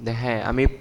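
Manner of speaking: ordinary talk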